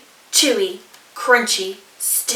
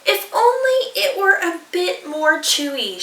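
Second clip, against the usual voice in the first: higher, wistful emu voice; a touch of a sigh; mild sighing emu; 7 semitones higher than usual. Acted. Emu sighing over the stew, higher. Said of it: "she sighed".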